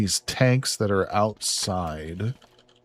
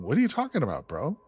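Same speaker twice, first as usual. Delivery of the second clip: ordinary talk, nothing marked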